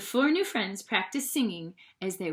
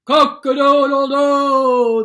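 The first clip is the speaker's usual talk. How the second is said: loud, slow cock-a-doodle-doo; squawking crow; 5 semitones higher, 14 dB louder, slower than usual. A narrator's reading. The upset rooster bursting into the road, loud crow. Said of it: "the rooster squawked".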